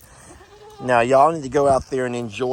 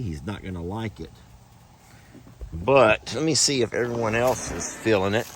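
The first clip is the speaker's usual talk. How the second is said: usual speaking voice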